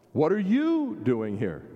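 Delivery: YOU stretched with a big swell in pitch; stress on YOU. Shown as a demonstration. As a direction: point the question at "you". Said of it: "put the emphasis on a different place".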